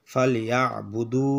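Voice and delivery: ফালিয়াবুদু spoken slowly, each syllable clear; slow recitation. Shown as a demonstration. Teacher sounds out the word for students, slowly. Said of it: "ফালিয়াবুদু লিখবো আমরা".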